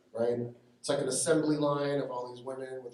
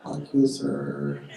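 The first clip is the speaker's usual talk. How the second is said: very slow, louder, sugary fake politeness; polite insult; 6 dB louder, much slower than usual. Shown as a demonstration. Filipino worker covering up anger with slow, exaggerated courtesy. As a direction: smile through the insult, drawing it out. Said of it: "They're like".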